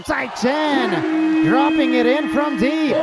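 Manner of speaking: high, loud, stretched-out shout; excited scoring call. Real, not acted. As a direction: hold the words long as the shot drops. Live call of a three-pointer.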